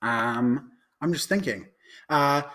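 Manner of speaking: ordinary delivery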